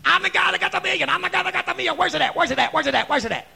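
high, loud, rapid-fire shouting; frantic excitement. Acted. Preacher acting out someone at the door for the million, frantic shouting. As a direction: yell it like an impatient winner. Acts out eager prize winner.

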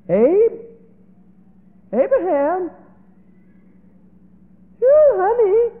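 high, loud, tearful calls; a mother's sobbing call; mother calling out crying. Acted. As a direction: cry out for her husband, voice breaking.

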